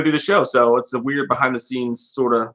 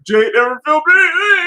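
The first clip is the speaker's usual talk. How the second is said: mock accusation; 7 dB louder than usual. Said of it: "I would turn around ... be like".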